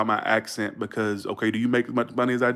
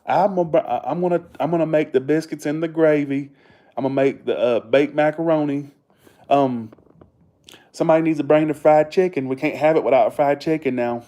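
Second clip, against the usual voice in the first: organize the cookout in broad Southern; Southern accent demo; 3 semitones higher, 5 dB louder than usual. Shown as a demonstration. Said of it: "Southerners usually talk like this".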